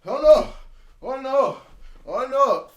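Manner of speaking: hammed-up stumbling boxer; punch-drunk boxer. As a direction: stagger through each oh no, punch drunk. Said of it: "feigning being punch drunk".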